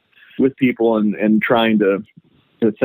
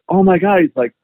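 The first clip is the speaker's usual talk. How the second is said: excited; 9 semitones higher than usual. Retold or imitated. Excited customer recognizing the farmer.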